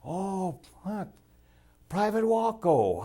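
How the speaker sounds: high, slow, drawn-out friendly hello; mock-cheery stranger calling Private Wacko; friendly greeting. Friendly Dutchman greeting the narrator.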